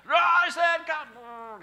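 put-on booming high shout to the kids; drawn-out commanding shout; Lazarus call. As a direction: bellow it like calling the dead from the tomb. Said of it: "you give them the Lazarus call".

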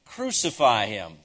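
loud but controlled quote of a shout; punched, restrained crowd chant; retold mob shout. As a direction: voice the mob's cry firmly, without yelling. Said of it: "the shout had turned to".

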